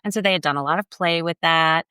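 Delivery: ordinary talk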